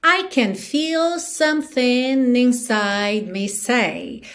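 slow, stretched lyric line; song rhythm. Shown as a demonstration. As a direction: recite slowly to the song's beat. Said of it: "tô te dando no ritmo da música".

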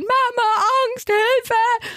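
high-pitched mock cry for help; retold little boy's scared shriek; imitated child's scream. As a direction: wail it like a panicking child. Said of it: "unglaublich geschrien".

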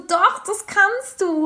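höher, langsam und gedehnt; nachdrücklich widersprechend. Retold or imitated. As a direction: jedes Wort betont langsam widersprechen. Zitiert eigene Antwort. (widerspricht dem Kindergartenmann, höher und langsam gedehnt)

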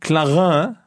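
Clarin with a French nasal ending; Clarin, guessed in mock French; tentative pronunciation. Shown as a demonstration. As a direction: try Clarin as a French name, asking. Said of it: "wie spricht man den denn aus".

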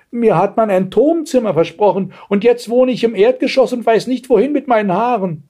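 put-on high-pitched aggrieved old maid; complaining Rapunzel. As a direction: complain like a cheated tenant. (klagende alte Jungfer, hohe Stimme)

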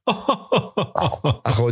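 unterdrücktes Lachen; stifled giggles. Real, not acted. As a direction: mit verbissenem Kichern sprechen. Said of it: "merkst du was?". (lachend, kaum beherrscht)

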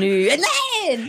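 indignant. Shown as a demonstration. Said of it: "dass du das sehr entrüstet sagen möchtest".

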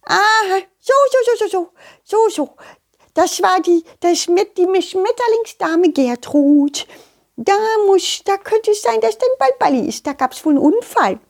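high, chattery butterfly voice; busy, knowing butterfly. Acted. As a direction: work it out aloud in a high creature voice.

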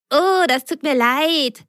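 delivery driver apologising to the blocked neighbour; apologetic call. Acted. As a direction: call over with a sorry. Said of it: "rief er dem jetzt lieben Herrn zu".

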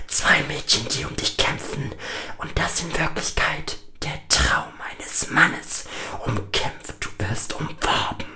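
whispered, sinister voice in his head; taunting inner voice. A narrator's reading. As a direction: whisper mockingly, as if haunting him. Taunting voice inside Lukas's head. (höhnisch flüsternde innere Stimme)

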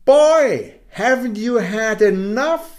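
lightly voiced moon. Good old moon asking the boy, lightly voiced. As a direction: mildly scold the boy as the kind moon. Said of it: "he asked him".

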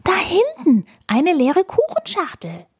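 put-on high excited girl's call; high eager child voice; excited discovery. Emi spots another clue.

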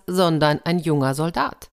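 plain reading voice

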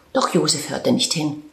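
usual speaking voice

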